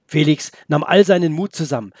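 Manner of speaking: ordinary delivery, nothing marked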